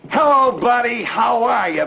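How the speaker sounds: "Hello, buddy" called high and loud; hearty hello. Shown as a demonstration. Puppet shows what howdy doody means, hearty. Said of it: "It means".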